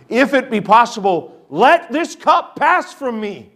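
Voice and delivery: anguished plea. Retold or imitated. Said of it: "crying out with agony to the Father".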